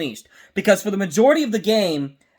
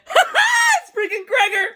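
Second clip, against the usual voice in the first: much higher, a laughing shout of recognition; excited laughing shout. Retold or imitated. Reliving spotting Gregor on screen, a higher excited laughing shout. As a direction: burst out laughing as you spot your favourite. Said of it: "And I literally went".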